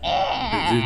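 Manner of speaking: ordinary talk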